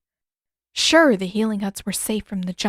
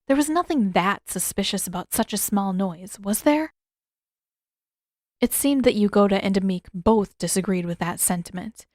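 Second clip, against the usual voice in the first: ordinary delivery, nothing marked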